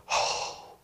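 mock stunned oh; breathy deflated oh. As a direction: exhale a stunned oh. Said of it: "I heard people go".

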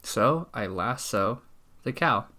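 usual speaking voice

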